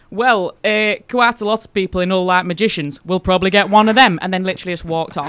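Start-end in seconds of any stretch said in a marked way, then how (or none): 0.1-4.2 flat deadpan answer, rising much louder; an unbothered bloke shrugging it off, loud; loud, flat, couldn't-care-less reply; deadpan council bloke. Retold or imitated. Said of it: "this bloke just deadpan just went".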